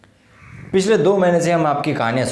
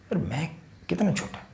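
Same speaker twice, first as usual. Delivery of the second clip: much softer, as if small beside him; humbled, quiet; 2 semitones higher, 9 dB quieter than usual. Acted. Man admitting he felt small beside Mehra sahab, quietly humbled.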